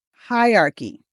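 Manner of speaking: "Hierarchy" as HI-ar-kee; three syllables. Shown as a demonstration. Coach modelling it for students, three syllables. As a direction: drop the middle "er" from "Hierarchy". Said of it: "say it as three syllables".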